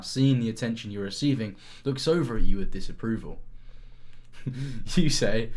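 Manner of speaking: ordinary delivery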